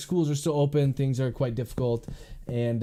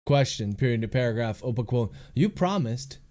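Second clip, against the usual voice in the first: measured dictation, calling out punctuation; dictating. Real, not acted. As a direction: read the draft aloud with spoken punctuation. Author dictating his novel aloud.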